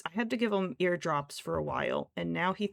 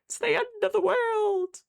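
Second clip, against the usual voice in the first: high, loud mock wail; cats' melodrama; 14 semitones higher, 9 dB louder, slower than usual. Voicing cats whining about eye cleaning, mock wail. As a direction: overplay the cats' tragic protest. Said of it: "And they're like".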